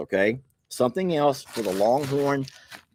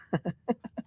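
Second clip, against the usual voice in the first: short high chuckle; breathy high laugh; 9 semitones higher, 8 dB quieter than usual. Real, not acted. Amused by a viewer's letter, short chuckle. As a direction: chuckle at the advice.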